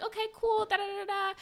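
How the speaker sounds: high, breezy okay-okay; eager agreement. Retold or imitated. Agreeing eagerly with the clinic lady. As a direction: rattle it off eagerly. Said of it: "So I'm like".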